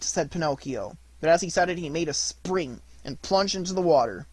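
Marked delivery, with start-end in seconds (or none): none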